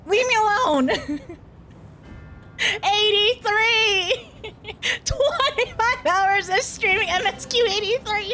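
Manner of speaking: high excited wail; overwhelmed squeal. Real, not acted. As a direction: squeal in disbelief, drawing it out. Overwhelmed by game scene.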